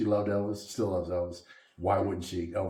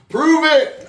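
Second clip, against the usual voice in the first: laughing shout; 12 dB louder than usual. Real, not acted. Laughing challenge.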